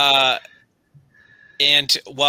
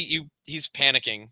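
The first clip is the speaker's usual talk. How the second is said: ordinary delivery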